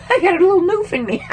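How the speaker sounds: high, fast giggling admission; laughing at herself. Real, not acted. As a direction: laugh it off at her own slip. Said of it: "I think the newfie".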